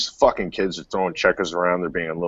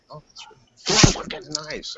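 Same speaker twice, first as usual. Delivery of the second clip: sarcastic whisper; 9 semitones higher than usual. Retold or imitated. Retold sarcastic taunt.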